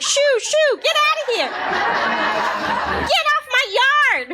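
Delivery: hammed-up get-off-my-lawn yell; shooing yell. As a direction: chase the kids off like a cranky old neighbor. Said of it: "I was like".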